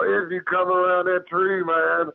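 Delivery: high-pitched, insistent retold warning. Retold threat to creature in tree.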